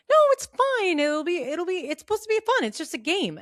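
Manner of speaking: high, chirpy mock coaxing; sing-song persuasion to play; mock friends. Friends coaxing her into a game, high mock-chirpy. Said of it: "And they're like".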